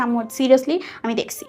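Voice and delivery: normal talking voice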